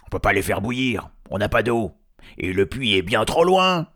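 complaining voice posing as troll. Acted. Hidden voice stirring up trolls, loud complaint.

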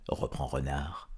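usual speaking voice

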